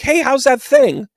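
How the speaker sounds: high, slow fishing hello; fake friendly hello. Psychic pretending to remember a client, high slow hello. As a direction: fish for a clue sweetly. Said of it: "I'm like".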